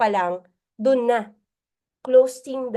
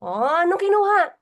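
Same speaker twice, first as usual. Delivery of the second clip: questioning mentor; 7 semitones higher than usual. Retold or imitated. Mentor questions member, acted exchange.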